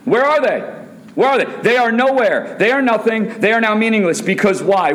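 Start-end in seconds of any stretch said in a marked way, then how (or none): 0.1-2.6 preaching at full force. Real, not acted. Preacher calling out to church.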